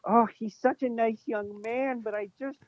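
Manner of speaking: high, slow offended mother voice; imitating his mom. Son mimicking his mother offended at the podcast, high and slow. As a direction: play a prim mom clutching her pearls. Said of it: "my mom is ... listening to this and being like".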